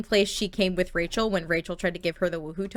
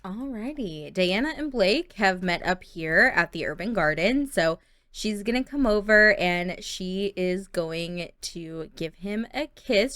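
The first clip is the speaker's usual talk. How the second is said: ordinary talk, nothing marked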